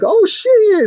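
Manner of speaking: high, loud panicked yelp; mock panic. Seated crowd spotting a monster, panicked. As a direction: yelp as if about to run.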